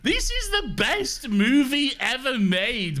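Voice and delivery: high, incredulous, over-the-top rave; gushing. Real, not acted. Film fan gushing to co-hosts about the movie, wildly excited. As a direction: rave about it, drawing out the praise.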